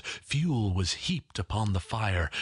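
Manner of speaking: usual speaking voice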